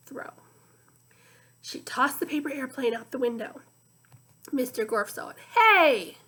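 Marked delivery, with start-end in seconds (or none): 5.4-6.2 sudden loud yell, higher; villain catching it, shouting. A narrator's reading. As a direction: bark it at the airplane. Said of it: "he shouted".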